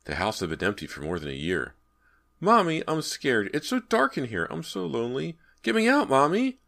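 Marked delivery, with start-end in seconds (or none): 2.4-6.6 put-on high, plaintive child's voice; frightened child begging for rescue; scared little boy. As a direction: plead from the dark, building to a loud cry. Scared trapped boy pleads to mother.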